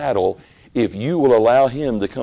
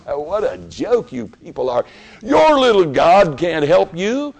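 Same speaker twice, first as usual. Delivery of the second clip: scornful jeer, louder on the last line; mocking scorn; 6 semitones higher, 3 dB louder than usual. Scoffers deriding the builders' God, scornful. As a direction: sneer at their little God. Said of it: "they were laughing".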